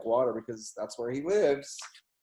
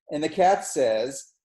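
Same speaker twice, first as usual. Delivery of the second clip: usual speaking voice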